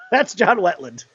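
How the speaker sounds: usual speaking voice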